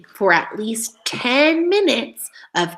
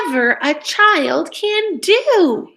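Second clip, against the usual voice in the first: slow, lifted, a touch louder show of excitement; excited reading; 6 semitones higher, 3 dB louder, slower than usual. Shown as a demonstration. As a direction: read it like an exclamation, slow and bright. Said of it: "those are when we sound excited".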